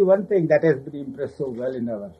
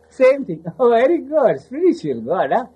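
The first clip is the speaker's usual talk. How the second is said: high, loud teasing "very good"; mock praise; 9 semitones higher, 4 dB louder than usual. Baba mocking caught disciples, high sarcastic praise. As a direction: laugh it out as a sarcastic compliment.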